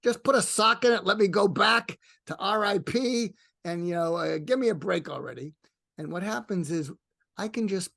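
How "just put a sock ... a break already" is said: put-on higher, louder grumpy Brooklyn accent; a fed-up old New Yorker from Brooklyn, pushing him away; annoyed Brooklyn-accented mentor grumbling at being woken; Larry King's Brooklyn accent. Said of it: "here's the loving thing he says".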